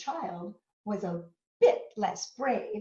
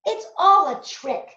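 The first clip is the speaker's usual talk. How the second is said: put-on high, loud scoffing child monster; defiant little Gruffalo; 10 semitones higher, 11 dB louder than usual. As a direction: scoff that it's a trick. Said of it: "said the Gruffalo's child".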